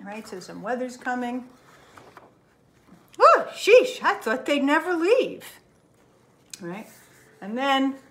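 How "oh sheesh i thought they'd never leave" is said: much higher and louder huffy sigh of relief; finally free of the guests; exasperated bear relief. Acted. Relieved after guests leave.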